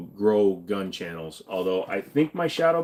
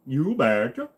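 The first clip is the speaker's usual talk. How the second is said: put-on Minnesota accent; 4 semitones higher, slower than usual. Replying to a North Dakota viewer.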